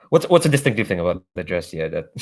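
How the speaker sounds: ordinary talk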